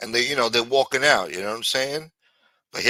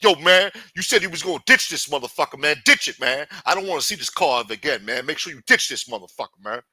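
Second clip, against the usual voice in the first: raised, hurried acted orders; insistent scolding; 6 semitones higher, 6 dB louder, faster than usual. Hakeem telling Lameek to ditch the car, agitated scolding. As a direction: press the order hard, loud and fast.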